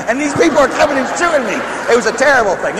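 normal talking voice

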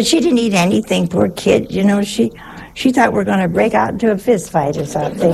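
ordinary talk

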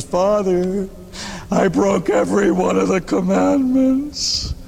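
a hint of a guilty parishioner owning up; penitent confessing. Parishioner confessing to the priest, mild sheepish voice.

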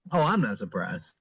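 low, offhand retold reply; nonchalant. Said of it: "completely nonchalantly said".

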